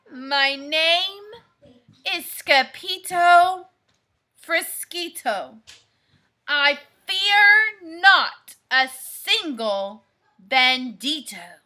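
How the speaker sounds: plain reading voice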